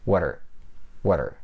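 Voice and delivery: "Water", what with a weak er attached; low, falling "water" for what are; reduced are. Shown as a demonstration. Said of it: "native speakers reduce it to just er".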